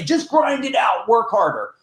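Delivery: mocking motivational shout. Mocking the guru who shouts to grind harder. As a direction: bark the empty slogan like a hype coach. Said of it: "If they're gonna say".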